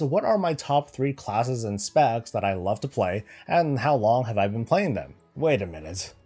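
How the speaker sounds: ordinary talk, nothing marked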